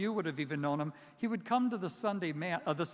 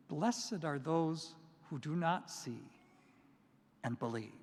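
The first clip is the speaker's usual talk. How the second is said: solemn beatitude; 3 semitones lower, 4 dB quieter than usual. Retold or imitated. Quoting Jesus's beatitude.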